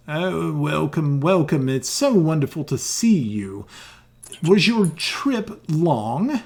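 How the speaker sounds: put-on slow, drawn-out welcome from an old elf; elderly elf ambassador. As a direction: greet weary travellers as a courteous old elf. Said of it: "an extremely elderly elf".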